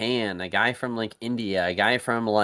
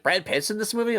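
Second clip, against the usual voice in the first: retold surprise; 12 semitones higher than usual. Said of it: "I'm like".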